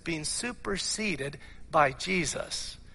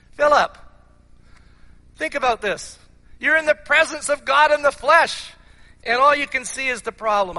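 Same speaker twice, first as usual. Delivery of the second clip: loud, high-pitched urgent appeal; exasperated rebuke; 7 semitones higher, 7 dB louder than usual. Acted. Jesus to Philip who sees only the problem, exasperated rebuke. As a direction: call him sharply to attention, voice raised.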